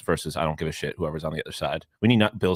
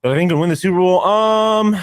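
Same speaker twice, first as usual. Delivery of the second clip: surprised; 10 semitones higher, 5 dB louder than usual. Real, not acted. Repeats host's question, surprised.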